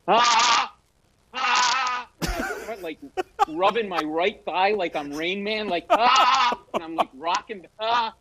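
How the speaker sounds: high, strangled flustered ahs; panicked stammering ahs. Retold or imitated. Flustered comic answering [name], high strangled ahs. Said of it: "These were the noises".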